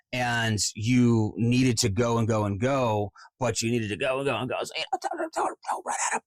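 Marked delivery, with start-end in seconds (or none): 3.4-4.6 "go and go and go" run together quickly; rushed. Shown as a demonstration. Coach mimics a long monologue, rushed. 4.6-6.2 squeezed high, quiet babble; running out of breath. Shown as a demonstration. Coach shows a monologue without belly breathing, running out of breath.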